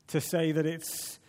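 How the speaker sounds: ordinary talk, nothing marked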